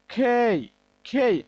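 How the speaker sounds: খেই said with an aspirated kh and an ey glide, not the flat কে; correct pronunciation. Shown as a demonstration. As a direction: stress the breathy start of খেই and glide the vowel. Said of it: "এটা হচ্ছে". (খেই উচ্চারণ, ফুঁ দিয়ে)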